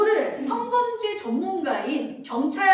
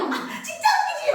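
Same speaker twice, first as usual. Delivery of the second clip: squealing high giggle; a kid laughing at something funny; high-pitched laughing kid voice; laughing child; 5 dB louder than usual. Retold or imitated. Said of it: "웃으면서 얘기해요".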